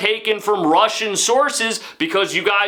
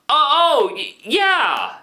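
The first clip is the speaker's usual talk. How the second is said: higher, halting fake remembering with a laugh; fake recognition; 5 semitones higher, slower than usual. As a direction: play the awkward pretend recall. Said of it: "she did not remember me at all".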